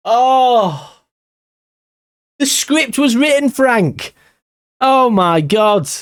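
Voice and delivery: high, pained crying out over the miss; despairing, almost in tears; mourning a missed winner, crying out; anguished groan. Real, not acted.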